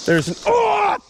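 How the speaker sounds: sudden very high shout of shock. Real, not acted. Angler yells as the fish breaks off, shocked shout. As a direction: cry out as the line snaps. Line snaps on big fish.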